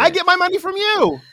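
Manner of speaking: very high, loud protest; mock child retort. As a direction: throw it back as the dependent kid. Child talking back to parent.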